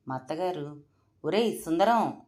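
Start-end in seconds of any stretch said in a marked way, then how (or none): 1.2-2.2 loud call across the house; shouting for the cook. A narrator's reading. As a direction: shout the cook's name to summon him. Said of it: "అంటూ కేకేశారు".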